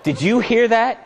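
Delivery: ordinary delivery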